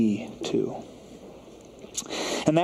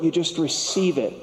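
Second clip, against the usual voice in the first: raised pitch, preacher making the point; higher emphatic delivery; 4 semitones higher than usual. Real, not acted. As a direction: say it higher, pressing the point home. Repeats the line for effect.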